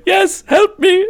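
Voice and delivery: slow, high, loud falsetto begging; imitated plea for help. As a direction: mimic a desperate player crying out. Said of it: "he was going".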